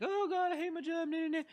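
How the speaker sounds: mock a worker moaning about the job; whiny complainer. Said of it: "and they're like".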